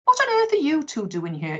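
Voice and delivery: curious. Acted. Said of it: "he asked curiously".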